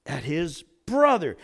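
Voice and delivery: ordinary delivery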